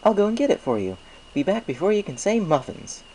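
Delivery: high, friendly shopkeeper pony voice; chirpy baker pleased with business; Mister Cake's voice. A narrator's reading. Baker thanks Applejack for the apples, chirpy and friendly. Mister Cake heads to back.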